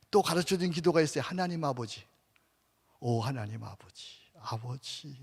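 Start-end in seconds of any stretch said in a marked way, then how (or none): 1.2-5.2 soft, lowered intimate calling; tender prayer. Shown as a demonstration. Preacher calls on Father in a tender hush. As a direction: call Father gently, close and tender.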